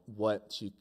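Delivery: ordinary delivery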